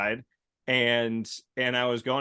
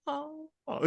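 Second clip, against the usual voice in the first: soft, very high muffled wail; muffled crying imitation; 13 semitones higher, 6 dB quieter than usual. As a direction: imitate a child crying behind glass, softly. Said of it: "like, a lower decibel".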